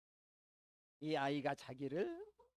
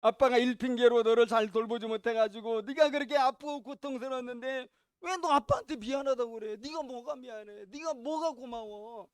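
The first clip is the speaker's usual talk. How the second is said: a father yelling, loud and high, at his son; anguished scolding outburst, loud and high; angry shout; 5 semitones higher, 13 dB louder than usual. Retold or imitated. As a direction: blow up in frustration at the boy. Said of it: "이 아빠는 막 화를 냈어요".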